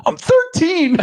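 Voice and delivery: very high imitation of an exasperated teen; indignant retort. Teenage daughter retorts to dad, indignant. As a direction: squeal it like an affronted kid.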